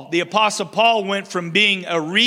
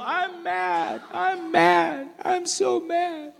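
put-on much higher, softer pouty toddler voice; little girl pouting; 11 semitones higher, 4 dB quieter, slower than usual. Little girl pounding the table, high pouty mock tantrum. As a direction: stamp out a cute toddler tantrum. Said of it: "a little girl ... makes her little fists".

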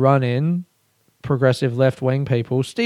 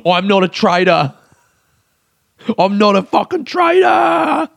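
screamed much higher, slowing on "fucking traitor"; hammed-up shouted denial; mock scream; 6 semitones higher, 3 dB louder than usual. Shown as a demonstration. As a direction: belt "I'm not a fucking traitor" as a comic meltdown. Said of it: "Me just screaming".

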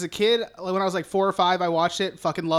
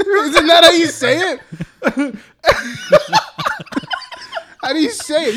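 loud, high, laugh-filled question; laughing protest; 9 semitones higher, 8 dB louder than usual. Real, not acted. Podcaster defending name pronunciation, laughing protest.

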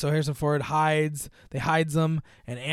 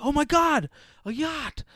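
excited exclamation; 11 semitones higher than usual. Retold or imitated. They spot a yacht.

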